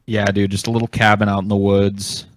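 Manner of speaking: ordinary talk, nothing marked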